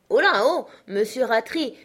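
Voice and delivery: loud calling shout, high on oh là; shout in the ear. Acted. Sacristan shouting into the frozen uncle's ear, loud call. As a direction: bellow to wake a frozen sleeper. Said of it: "s'écria-t-il dans l'oreille de Benjamin".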